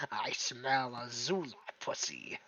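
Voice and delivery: low, soft echo of the creature voice; quoting creepy line. Retold or imitated. S3 explaining where the line came from, lightly deep. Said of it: "I saw a post that said".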